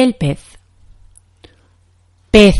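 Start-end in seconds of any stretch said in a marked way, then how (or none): 2.3-2.6 Pez with a lisped z, tongue between the teeth; Spain Spanish pez. Shown as a demonstration. Teacher showing learners the Spain pronunciation, Pez with a th. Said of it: "En español de España decimos pez".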